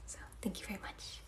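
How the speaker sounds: softly whispered thanks. Real, not acted. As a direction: whisper your gratitude to the viewers.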